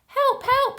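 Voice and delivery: high, loud cry for help; shouting for help. A narrator's reading. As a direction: yell it from the treetop. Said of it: "he shouted".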